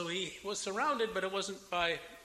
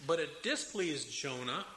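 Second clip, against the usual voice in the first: ordinary talk, nothing marked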